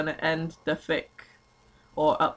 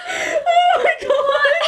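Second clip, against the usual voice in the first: helpless laughter; 5 dB louder, slower than usual. Real, not acted. Cracks up at the name.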